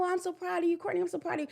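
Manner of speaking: high, sing-song empty praise; generic praise. Shown as a demonstration. Mother giving her daughter shallow praise, high sing-song. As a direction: gush without meaning it.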